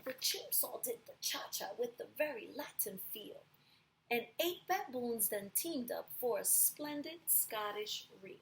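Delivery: lively storybook read aloud; storyteller voice for a class; reading to children. A narrator's reading. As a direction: read it like a picture book for kids. Reads picture book to class.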